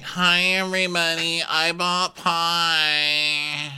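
high, long-drawn singsong greeting; Amanda. Retold or imitated. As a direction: announce it high, stretching each word. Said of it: "And Amanda's like".

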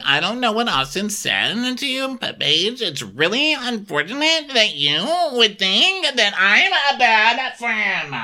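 high, mocking whiny Lindsay voice; lampooning a self-righteous friend. Podcaster mocks reality star Lindsay, high whiny parody.

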